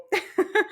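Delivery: short, high-pitched laugh. Real, not acted. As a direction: laugh at your own embarrassment. Laughs at own embarrassing memory.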